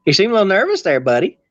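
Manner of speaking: teasing, put-on Southern twang; heavy Southern accent. Shown as a demonstration. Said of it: "heavy Southern accent".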